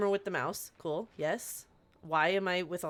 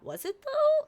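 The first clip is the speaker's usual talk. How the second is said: incredulous; 4 dB louder, slower than usual. Retold or imitated. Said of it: "And I was like".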